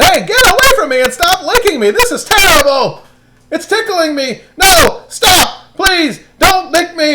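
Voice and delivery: high, loud squealing protest; tickled and protesting. Acted. Daniel being licked by lions, squealing high and loud. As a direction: squirm away from licking lions.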